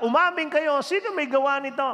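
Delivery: high, loud tearful demand for a confession; angry crying demand. Retold or imitated. Class advisor demanding the students confess, angry and tearful. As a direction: shout it out, furious and near tears. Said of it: "galit na galit yung advisor, umiiyak".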